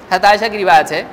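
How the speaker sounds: ordinary talk, nothing marked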